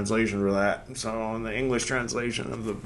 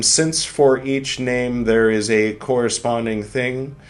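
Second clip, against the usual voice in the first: measured, louder reading voice; reading aloud; 5 semitones higher, 10 dB louder, slower than usual. Acted. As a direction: read it with gravity. Reads the book aloud.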